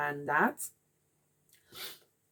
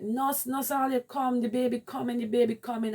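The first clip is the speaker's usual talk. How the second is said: urgent call; 6 semitones higher, 3 dB louder than usual. Retold or imitated. Calling staff as baby crowns.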